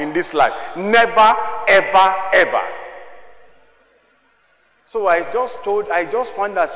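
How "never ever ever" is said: louder, hammered nevers; emphatic shout. Real, not acted. Preacher insisting on a life lesson, loud and emphatic. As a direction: drive it home.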